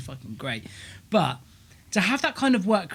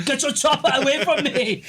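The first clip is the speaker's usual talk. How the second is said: higher, laughing mock outrage; mock angry; 7 semitones higher, 4 dB louder than usual. Retelling his mate's gym outburst, laughing mock anger. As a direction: giggle through the fake fury. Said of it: "He was so angry".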